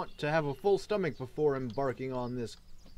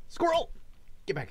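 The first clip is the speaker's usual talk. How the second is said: sudden high yelp at the squirrel; excited shout; 13 semitones higher, faster than usual. Real, not acted. Spots squirrel, chases it.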